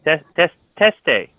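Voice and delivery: teste said slowly, stressing the hard T; careful slow demo of teste; spelled-out pronunciation. Shown as a demonstration. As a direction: slowly sound out tes- tes- teste. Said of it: "teste with a T".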